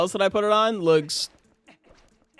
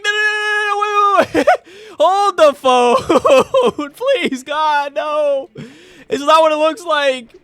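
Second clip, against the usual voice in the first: much higher, louder, laughing panic; mock panicked pleading; 10 semitones higher, 8 dB louder, slower than usual. Defending herself to accusing chat, hammed-up panic. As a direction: plead frantically through laughter. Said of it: "And I was just like".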